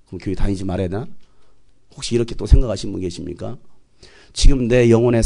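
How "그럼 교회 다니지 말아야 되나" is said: low, quiet self-questioning; much softer, lower wondering voice; doubting churchgoer. Acted. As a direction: think aloud as a discouraged listener. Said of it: "이 말씀 들으면서".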